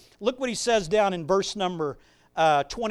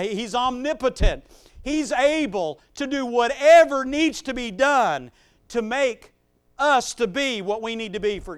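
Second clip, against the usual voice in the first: loud, emphatic preaching; 5 semitones higher, 6 dB louder than usual. Acted. Preacher insisting to his church that Jesus is all-powerful, loud. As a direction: drive home omnipotent with force.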